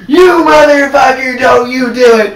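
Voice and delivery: slow, high, loud yell; yelling at a horror movie character; shout at the screen. Retold or imitated. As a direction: draw out the warning, full voice. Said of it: "It was like".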